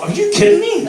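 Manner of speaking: very high-pitched mock disbelief; incredulous. Man retelling his reaction to the woman, high incredulous voice. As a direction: squeak it out, hammed-up incredulity.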